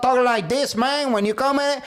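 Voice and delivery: mock Scarface accent. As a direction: mimic the friend's fake Cuban accent. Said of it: "this is the shit I'm talking about".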